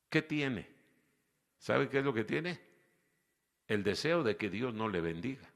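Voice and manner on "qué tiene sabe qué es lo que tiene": normal talking voice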